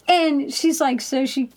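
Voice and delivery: ordinary delivery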